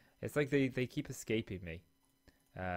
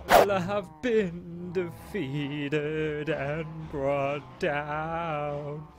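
slow, high, drawn-out dramatic defeat; a hammed-up lament over losing the office to dogs; mock tragic lament; 6 semitones higher, 6 dB louder, much slower than usual. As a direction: ham up a heroic downfall, slowly.

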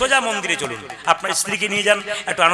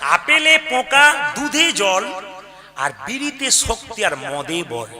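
slow, loud, high mock recitation; drunkard's rhyme again; 4 semitones higher, 3 dB louder, much slower than usual. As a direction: relish it, hamming up the drunk. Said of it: "ও আমাকে কি সুন্দর বলছে". (উঁচু গলায় টেনে টেনে মাতালের ছড়া)

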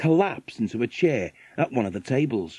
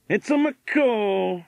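higher-pitched American millionaire voice; 9 semitones higher, slower than usual. A narrator's reading. Millionaire pointing something out to his wife, American character voice. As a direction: play the rich American husband, high and drawn out.

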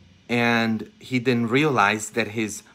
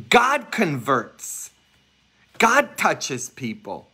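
God converts, each word slow and weighty; slow emphatic preaching; 3 semitones higher, slower than usual. Real, not acted. Preacher to congregation, slow and solemn. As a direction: lean on it slowly like a truth.

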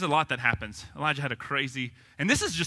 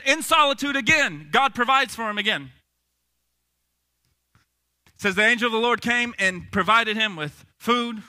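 ordinary talk

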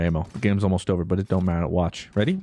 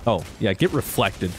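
ordinary talk

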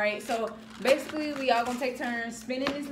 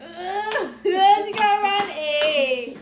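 singsong laughing; 8 semitones higher, 6 dB louder, much slower than usual. Real, not acted. Laughing singsong, rotten egg.

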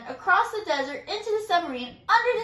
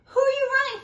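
high, quick curious voice; panda's question; 11 semitones higher, 7 dB louder, much faster than usual. A narrator's reading. Panda asking the running mouse. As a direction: chirp it out fast. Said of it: "asked the panda".

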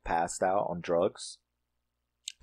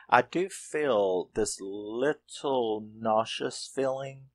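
ordinary delivery

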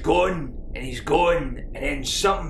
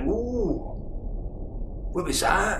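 startled whoa; 6 semitones higher, slower than usual. Acted. Wee guy startled by a noise in the bush, higher.

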